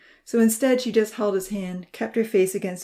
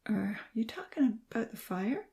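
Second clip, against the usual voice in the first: soft, drowsy whisper; sleepy voice; 4 semitones higher, 6 dB quieter than usual. A narrator's reading. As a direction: ask as if half asleep. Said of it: "In a sleepy voice".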